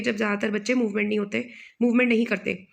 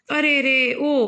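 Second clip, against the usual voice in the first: a louder cry of pain; louder groan; 4 semitones higher, 4 dB louder, much slower than usual. A narrator's reading. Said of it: "और भी तेजी से करा रहा है".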